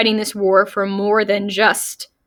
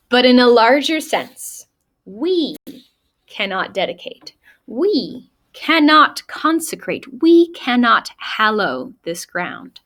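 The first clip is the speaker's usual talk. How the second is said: oratorical, with each "we cannot" set apart by pauses; deliberate recitation, leaning on the repeated "we cannot"; emphatic speech reading; 3 semitones higher than usual. Acted. Reads the speech aloud.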